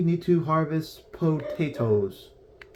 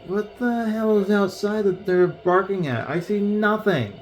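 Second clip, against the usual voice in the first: exasperated; 8 semitones higher than usual. Real, not acted. Puzzled by dogs barking.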